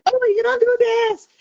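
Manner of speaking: high, put-on whiny complaint; mocking whine, high and childish; whining mock voice. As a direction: mimic a nagging whiner, pitched high.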